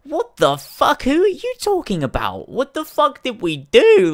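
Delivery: what on earth did we do, loud; incredulous replay of his reply; outraged disbelief. Retold or imitated. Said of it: "I'm like".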